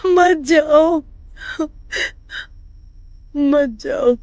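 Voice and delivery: crying plea, voice breaking; रोती हुई विनती. Acted. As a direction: beg through tears not to be left alone. सास रोते हुए बहू से विनती. (रोते हुए गिड़गिड़ाना)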